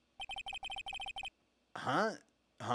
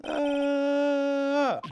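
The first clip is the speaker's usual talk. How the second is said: long high scared scream; 10 semitones higher, 6 dB louder than usual. Acted. Streamer screaming as the boss catches them snooping. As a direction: let out a long shriek.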